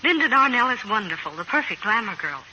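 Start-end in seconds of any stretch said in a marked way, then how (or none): none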